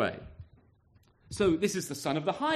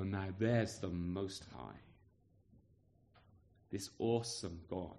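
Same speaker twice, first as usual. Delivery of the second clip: hushed awe; 12 semitones lower, 14 dB quieter than usual. Retold or imitated. Preacher voicing a believer's hushed awe at God. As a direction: breathe it out in quiet awe at God's greatness. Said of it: "And you go".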